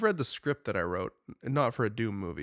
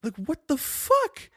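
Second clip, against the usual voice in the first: incredulous; 5 dB louder, slower than usual. Real, not acted. Incredulous at game slogan.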